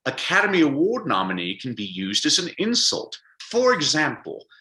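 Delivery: put-on British award-speech voice; British accent. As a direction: deliver it as a wry British acceptance speech. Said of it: "so I'm gonna do my British accent".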